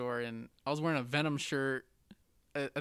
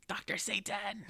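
higher, a voice unlike his own, theatrical; movie character impression; 6 semitones higher, 5 dB quieter, slower than usual. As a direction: call it out like a horror film villain. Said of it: "the House of Thousand Corpses thing".

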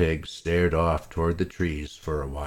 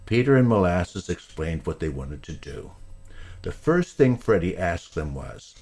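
ordinary delivery, nothing marked

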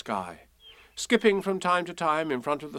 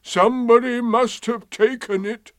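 high, loud, aggrieved donkey; mournful accusation; Eeyore; 11 semitones higher, 6 dB louder than usual. A narrator's reading. Eeyore suspecting a tail thief, gloomy. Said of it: "said Eeyore".

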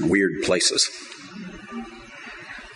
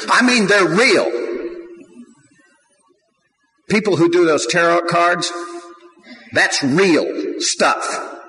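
raised, higher preaching push; fiery preaching; 7 semitones higher, 3 dB louder than usual. Acted. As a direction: drive the warning home to the congregation. Preaching at full force.

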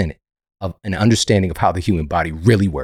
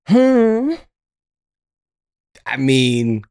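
usual speaking voice

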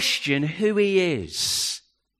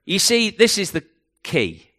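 usual speaking voice

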